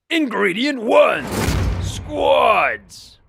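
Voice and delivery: slow, booming announcer. Acted. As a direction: proclaim it like a trailer voice. Announcer voice introducing section.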